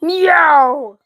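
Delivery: high, loud falling meow; hammed-up angry cat. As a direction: meow right back at the cat. Meowing back at the cat.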